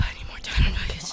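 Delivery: unvoiced whisper. Real, not acted. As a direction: whisper it under breath. Whispered aside.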